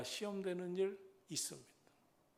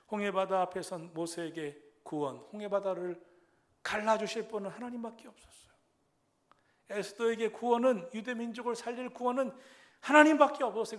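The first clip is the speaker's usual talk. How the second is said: raised, driving pulpit delivery; full-force preaching; 4 semitones higher, 7 dB louder than usual. Real, not acted. Preacher declaring Christ the only hope, preaching forcefully. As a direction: proclaim it with urgent, insistent force.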